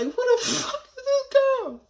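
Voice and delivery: very high, slow, stunned squeal; a scared kid freaking out at mom; bewildered kid. Retold or imitated. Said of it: "I looked at my mom like".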